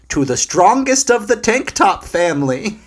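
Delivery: high, loud dramatic hype; hyped announcement. Real, not acted. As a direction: announce it like a wrestling promo. Hyping up a favourite character.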